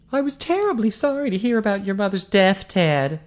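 high-voiced woman offering genuine sympathy; sympathetic Eileen. A narrator's reading. Eileen consoling Ted on his mother's death, sympathetic. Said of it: "Her voice was soft now. Her sympathy was genuine".

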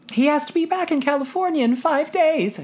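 put-on high girlish voice, pleading; pleading daughter. A narrator's reading. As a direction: coax her father. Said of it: "she pleaded".

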